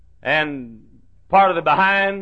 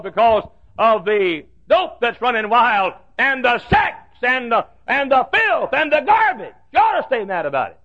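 full-force preaching; 6 semitones higher than usual. Acted. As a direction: build the list to a shout.

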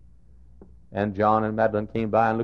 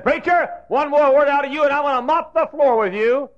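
high, loud mock threat; gruff threat; 10 dB louder than usual. Custodian threatening the teasing preacher, loud high mock threat. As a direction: bark it like a riled custodian, loud. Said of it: "He said".

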